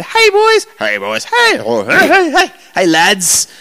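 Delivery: high, laughing mock English "hey, boys"; mock English accent. Comedian beckoning the boys into his room, in a hammed-up mock English accent. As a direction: ham up an English bloke calling the lads in. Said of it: "he wasn't English".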